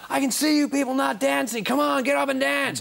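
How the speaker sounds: hammed-up stadium rocker geeing up the crowd; rock frontman. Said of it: "And he".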